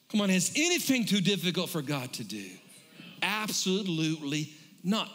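pulpit-pounding revival preaching; fiery preaching. Acted. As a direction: preach it hard and build the room up. Preaching at full force.

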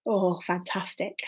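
usual speaking voice

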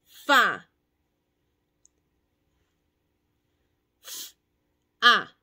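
"Fa" y luego "F", "A" sueltos; segmentar en fonemas. Shown as a demonstration. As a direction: sonido por sonido. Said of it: "la van a cortar en sonidos". (segmentando la sílaba)

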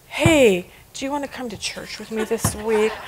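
sweet invitation. Acted. Boss sweetly inviting to church.

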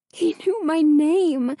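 slow, breathless and shaken. A narrator's reading. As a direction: say it short of breath. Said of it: "Hannah said breathlessly".

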